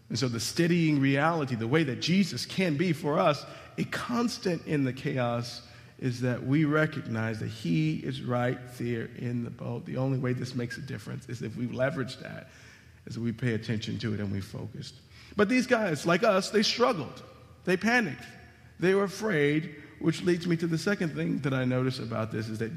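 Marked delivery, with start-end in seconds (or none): none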